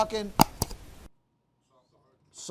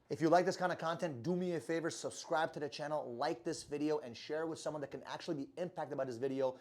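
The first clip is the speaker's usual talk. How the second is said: lower, softer channel sign-off read; quiet outro; 6 semitones lower, 11 dB quieter than usual. Acted. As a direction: wind down and ask them to subscribe, softly. Channel outro, subscribe request.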